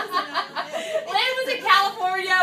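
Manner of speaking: laughing while telling it, slowed down. Real, not acted. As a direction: laugh through the words. Laughing at the memory.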